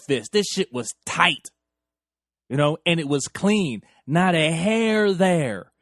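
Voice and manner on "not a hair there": lingering, awed reveal; savoring. Real, not acted. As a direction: stretch every word out in awe. Slow savoring description.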